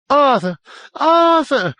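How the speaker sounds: high, loud cry nearly unhinged; shrill, penetrating call from upstairs; piercing shriek. A narrator's reading. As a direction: shriek the name so it knifes down the stairs. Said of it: "Her voice was piercing, penetrating".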